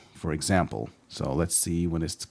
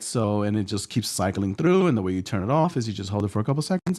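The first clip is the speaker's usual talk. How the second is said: ordinary talk, nothing marked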